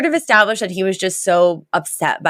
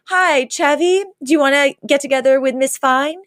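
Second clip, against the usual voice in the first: higher-pitched mock phone chat; fake phone call; 8 semitones higher than usual. He pretends to invite Chevy, fake phone-call voice. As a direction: lightly act out a pretend call. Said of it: "pulls out a fake phone".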